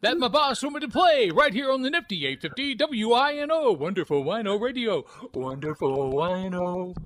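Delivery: loud, high, hyped AM radio DJ patter; old radio DJ. Retold or imitated. Jock hypes a caller on the radio, loud and high. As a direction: sell it like a fast-talking top-forty jock. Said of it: "That's an old Carlin routine".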